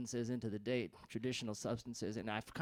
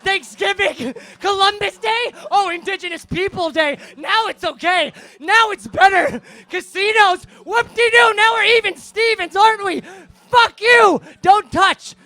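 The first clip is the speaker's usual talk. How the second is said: much higher and louder mocking yell; screaming sarcasm; 25 dB louder, slower than usual. Singer mocking the audience's holidays, screamed.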